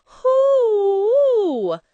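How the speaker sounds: long high mournful howl; loud sad dog howl. Acted. The saddest howling sound.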